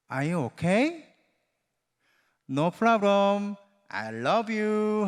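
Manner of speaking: slow, gentle English reassurance; tender American mom. Retold or imitated. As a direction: soothe the child like a loving American mother. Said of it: "먼저 이렇게 해요". (천천히 다정하게 달래는 미국 엄마)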